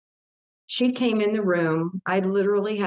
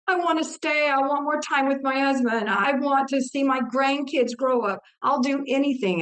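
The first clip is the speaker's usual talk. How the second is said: higher pleading voice, imitating the plea; begging; 7 semitones higher than usual. Begging Jesus to let her stay: raised, imitated plea. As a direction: beg for more time, hands clasped in the voice. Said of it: "and I am begging".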